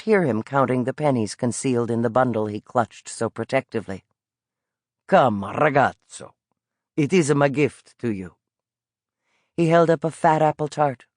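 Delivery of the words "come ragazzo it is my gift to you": put-on old street vendor with an Italian lilt, calling out. Old vendor coaxing the boy toward his pastry cart, Italian accent. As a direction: coax the boy over like a friendly Italian peddler.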